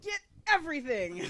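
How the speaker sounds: body's eager call. Retold or imitated. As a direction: grab it all. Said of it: "they were like".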